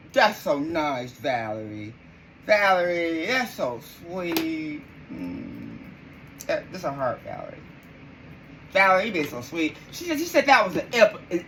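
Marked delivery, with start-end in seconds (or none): 0.1-9.8 much lower, softer mock tenderness; teasing sweet. Real, not acted. Streamer teases chatter Valerie for her kind guess, low cooing. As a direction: coo at her sarcastically.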